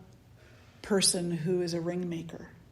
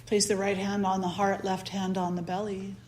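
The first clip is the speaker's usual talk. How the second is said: guided meditation; 7 dB quieter than usual. Acted. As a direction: ease them into the breath, gently.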